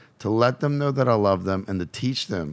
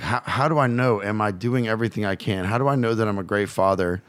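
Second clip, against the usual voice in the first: normal talking voice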